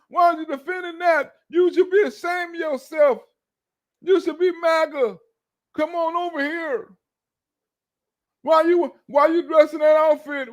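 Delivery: high-pitched yelling at the police; mock protester yelling. Imagined MAGA protester shouting at police, high mock yell. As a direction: harangue the officers, hammed up. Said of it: "fight against the police".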